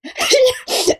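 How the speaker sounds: high, bursting laugh at her own joke; burst of laughter. Real, not acted. Comic laughing at her own line about her friend's girlfriend. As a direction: break into a big high laugh.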